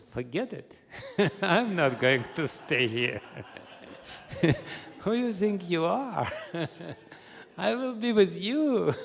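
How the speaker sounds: laughing through a defiant reply; laughing refusal. Acted.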